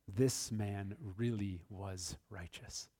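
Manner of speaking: slow, soft, low wonder; awed centurion. Retold or imitated. Pastor voicing the centurion, slow and hushed in awe. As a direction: let it sink in quietly, slowly. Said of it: "And he said".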